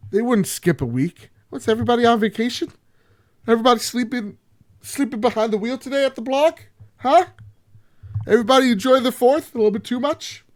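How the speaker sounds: high, indignant rhetorical questions; mock scolding. Host grills the blog for skipping a week, mock indignant. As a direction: needle them with rising mock outrage.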